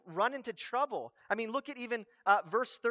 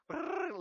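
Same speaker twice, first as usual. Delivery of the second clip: drawn-out brrr of riffled pages; page-flipping noise; 9 semitones higher, 4 dB quieter than usual. Retold or imitated. Preacher mimics another random Bible dip, long playful trill. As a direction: trill like pages flicking past.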